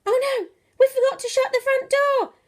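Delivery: ordinary delivery, nothing marked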